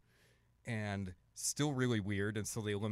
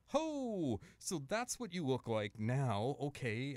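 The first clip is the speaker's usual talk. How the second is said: high drawn-out "ho", then slower; imagined surprised reaction; 5 semitones higher, 3 dB louder, much slower than usual. Retold or imitated. Contestant seeing the faces at last, mock surprise. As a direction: act out a big mock reveal. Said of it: "And you're like".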